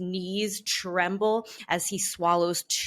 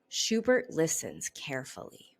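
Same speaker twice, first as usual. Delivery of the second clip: usual speaking voice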